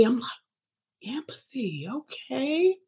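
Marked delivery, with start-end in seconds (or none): 1.0-2.8 whispered first word, then high lilting okay; hushed echo rising into teasing approval; mock-amazed reaction; impressed surprise. Reacting to client's sudden empathy.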